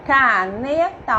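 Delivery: slow ca-ne-ta, each beat held; syllable by syllable. Shown as a demonstration. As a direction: split "Caneta" into three drawn-out syllables. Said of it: "em cada sílaba".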